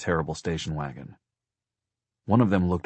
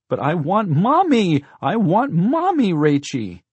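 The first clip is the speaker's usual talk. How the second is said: put-on very high, loud, drawn-out child wail; slow, piercing little-kid whine; wailing little boy; 14 semitones higher, 9 dB louder, slower than usual. A narrator's reading. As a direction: plead like a distraught small boy. Little brother wailing for his mother.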